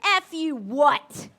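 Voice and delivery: loud, slow, stern coach challenge; the coach putting a cocky player in his place; menacing challenge. Retold or imitated. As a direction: stare him down and drag it out slow. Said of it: "she gives him eyes and she goes".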